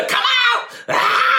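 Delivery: mock frantic shout. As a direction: parody an immature minister casting out. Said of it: "they're gonna do the".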